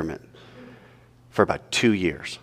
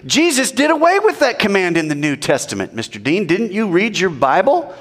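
mocking voice; 9 semitones higher than usual.

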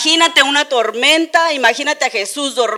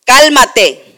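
loud stern order; commanding shout; 10 dB louder than usual. Acted. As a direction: order the sea to be calm. Jesus rebukes the sea.

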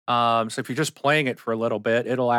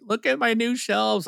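excited; 10 semitones higher than usual. Retold or imitated. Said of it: "I was like".

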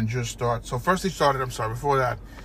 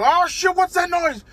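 high, loud yelp of a scared ant; startled ant; 7 dB louder, slower than usual. Acted. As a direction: jump at the noise like a tiny panicked ant. Ants hear the vacuum again.

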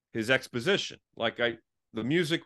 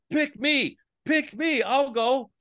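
high, bright pick me plea; eager volunteer; 13 semitones higher, much slower than usual. Pretend. A soldier answering the commander, eagerly volunteering. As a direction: beg to be chosen for the mission. Volunteering for tough mission, imagined.

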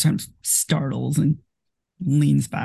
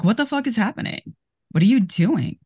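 much higher, fast alarmed cry; shocked outburst; 8 semitones higher than usual. Acted. Erica jumping up at the strangling, shocked and alarmed. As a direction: leap up horrified at what's happening.

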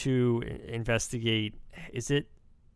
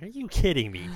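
higher-pitched mock yell of disbelief; retold yell; 7 semitones higher than usual. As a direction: re-enact yelling at the screen, half-hearted. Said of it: "I literally yelled at my screen".

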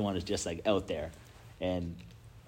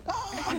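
excited scream. Retold or imitated. Kids racing into the ocean.

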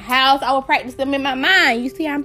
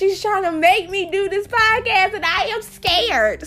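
high-pitched mock complaint; hammed-up fed-up protest; exasperated whine; 7 semitones higher than usual. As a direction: whine about being pushed, voice climbing. Acted exasperation at nagging friend.

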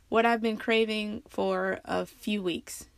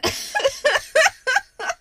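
laughing cry; 6 dB louder than usual. Retold or imitated. Reliving the sushi disaster.

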